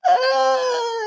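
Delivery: very high, drawn-out mock scream; imitated scream. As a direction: wail like the kid hitting the ground. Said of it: "and he's like".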